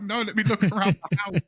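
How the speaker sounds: high, loud, overexcited gushing; giddy excitement. Retold or imitated. Gamer telling friends he's Spider-Man, high giddy squeal. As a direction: squeal like a kid living his dream. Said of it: "It's like".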